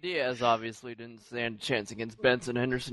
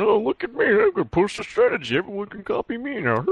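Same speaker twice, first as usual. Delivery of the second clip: mocking braggart; 9 semitones higher, 4 dB louder than usual. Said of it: "that Walnut dude's taking all the credit".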